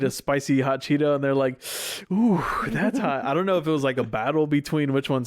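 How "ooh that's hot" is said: higher, drawn-out mock reaction to heat; slow, pitched-up imitation of feeling the burn; reacting to spiciness. Host voicing jungle animals eating a spicy Cheeto, mock burning mouth.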